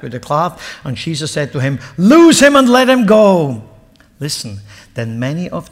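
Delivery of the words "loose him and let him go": loud, high, slow order; ringing command. Acted. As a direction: command it across the tomb. Jesus commands at the tomb.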